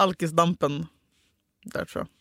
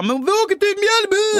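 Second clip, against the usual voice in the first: high, bright rallying cry, much higher; excited call; 14 semitones higher, 4 dB louder than usual. Retold or imitated. Urging her friends to join the bus trip, excited call.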